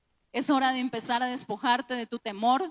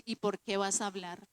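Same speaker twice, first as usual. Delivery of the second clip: low, soft inner rebuke; quietly confront, pitched below your usual voice; understated, low corrective voice; Espíritu Santo; 5 semitones lower, 5 dB quieter than usual. Retold or imitated. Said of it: "el Espíritu Santo me dice".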